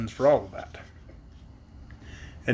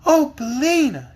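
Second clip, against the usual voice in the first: high, louder happy cry; joyful greeting, slow; glad cry; 10 semitones higher, 5 dB louder, slower than usual. Acted. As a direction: greet the hen with delight. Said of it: "uttering at the same time a glad cry".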